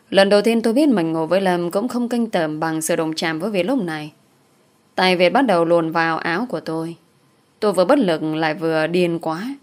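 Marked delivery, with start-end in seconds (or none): none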